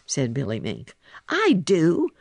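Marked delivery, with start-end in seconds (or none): 1.3-2.1 loud, high, eager cry; raised, emphatic outburst. A narrator's reading. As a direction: cry it out suddenly. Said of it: "cried Little Joe".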